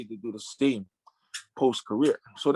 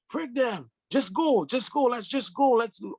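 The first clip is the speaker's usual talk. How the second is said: much higher, pleading push to leave; crying servants urging; 10 semitones higher than usual. Retold or imitated. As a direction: voice the boys begging him to walk out. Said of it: "they're all crying".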